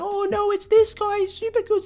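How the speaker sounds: high-pitched panicky opponent imitation; mock alarm. Players dreading a popular opponent, in high mock alarm. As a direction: squeal it like a worried rival.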